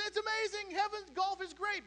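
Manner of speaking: golfer's giddy hole-in-one joy; thrilled golfer. Acted. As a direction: squeal it like you can't believe your luck.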